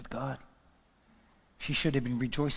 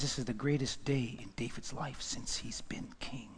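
whisper; 3 semitones lower, 10 dB quieter than usual. Real, not acted. Preacher hushes over David's joy.

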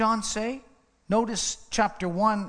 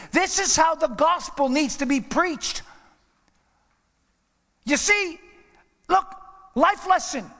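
higher, louder sermon climax; full-force preaching; 9 semitones higher, 4 dB louder than usual. Acted. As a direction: preach it out to the congregation.

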